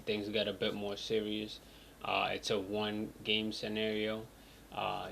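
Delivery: ordinary talk, nothing marked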